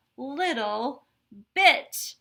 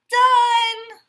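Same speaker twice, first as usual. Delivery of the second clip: very high, loud finishing cry; victorious whoop; triumphant shout; 11 semitones higher, 12 dB louder than usual. Acted. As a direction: yell it as you win again. Straw races to finish again.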